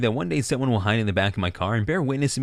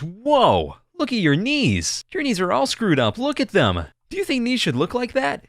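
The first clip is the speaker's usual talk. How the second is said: shocked exclamation; 11 semitones higher, slower than usual. Acted. Doctor gawks at patient's screwed-up knees, shocked high-pitched exclamation. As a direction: exclaim in hammed-up alarm, pitched way up.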